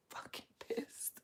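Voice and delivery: hushed, slow, seething aside; whispered anger. Real, not acted. Vlogger confessing her anger to camera, whispered and slow. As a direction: breathe it out under your breath.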